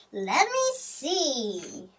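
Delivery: ordinary talk, nothing marked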